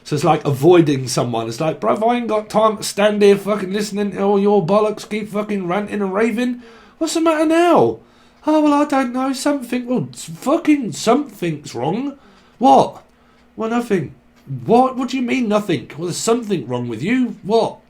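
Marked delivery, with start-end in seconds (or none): 1.8-8.0 high-pitched fed-up rant, climbing at the end; acted exasperation. Avoider fobbing off a ranting partner, exasperated. 8.5-17.8 high-pitched tetchy back-and-forth; acted bickering. Couple squabbling over what's wrong, tetchy.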